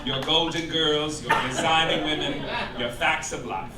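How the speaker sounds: ordinary talk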